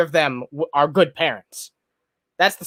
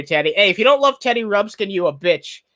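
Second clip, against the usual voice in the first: ordinary delivery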